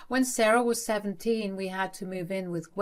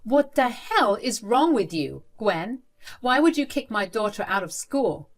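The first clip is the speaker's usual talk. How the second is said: loud, furious confrontation, pitch pushed higher; tearing into her; 4 semitones higher, 6 dB louder than usual. Acted. Father yelling at his mother over his daughter, furious. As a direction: lay into her with full anger. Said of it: "I absolutely tore into her".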